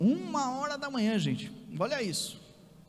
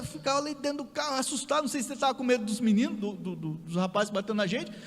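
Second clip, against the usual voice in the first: normal talking voice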